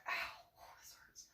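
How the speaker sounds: barely audible whispered "ow"; whispered pain. Retold or imitated. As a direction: whisper it as if nursing a hurt. Acts out hurting alone.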